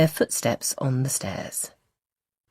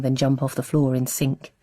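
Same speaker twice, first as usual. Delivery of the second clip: ordinary delivery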